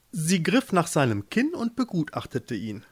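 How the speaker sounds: usual speaking voice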